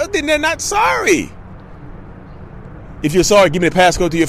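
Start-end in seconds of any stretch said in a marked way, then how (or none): 0.1-1.3 high slow mock voice. Dismissive punchline.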